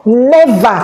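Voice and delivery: NEVER hit loud and falling; Never punched loud and high; emphatic never. Real, not acted. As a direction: slam the door on it with Never. Vows never to return.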